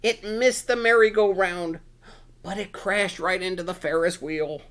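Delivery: ordinary delivery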